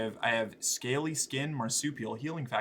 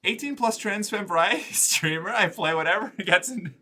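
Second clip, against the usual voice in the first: laughing; 6 semitones higher, 5 dB louder than usual. Real, not acted. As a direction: repeat it higher, louder, laughing. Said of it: "repeats intro laughing".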